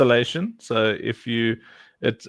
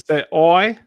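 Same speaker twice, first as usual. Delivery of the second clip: South African accent; 4 semitones higher, 4 dB louder than usual. Shown as a demonstration. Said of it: "speaking with a South African".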